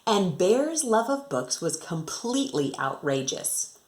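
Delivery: lively singsong storybook reading; reading to children. Acted. Teacher reading a rhyming picture book to her class, lively and singsong. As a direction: bounce the rhyme for young listeners.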